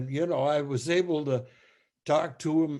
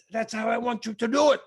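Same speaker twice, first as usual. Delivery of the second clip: imitated conductor; 9 semitones higher, 5 dB louder, faster than usual. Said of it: "He said".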